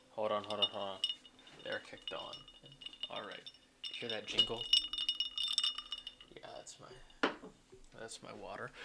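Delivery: distant, off-mic voice; talking across the room; far from mic. Real, not acted. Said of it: "as I walk away from the microphone".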